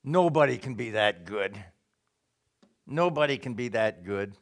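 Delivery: a hint of a scornful Satan; Satan's taunting voice. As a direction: sneer it like the devil doubting you. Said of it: "Satan's going to say".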